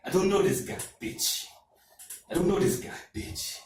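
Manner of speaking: put-on high African accent, punchy then dropping. African coworker denies knowing [name], African accent. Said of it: "African guys, too, from Africa".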